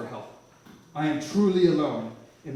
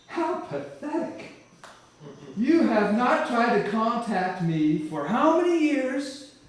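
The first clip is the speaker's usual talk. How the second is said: higher, slow, scornful rebuke from above; a disappointed God dressing him down, slowly; God mocks the man who prays only in trouble, higher and slow; voice of God scolding; 7 semitones higher, 4 dB louder, slower than usual. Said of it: "This voice says".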